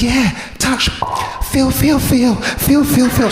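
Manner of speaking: put-on eager, chanting girl inviting a touch; excited friend. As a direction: friend showing off her new boobs, high and singsong. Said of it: "She goes".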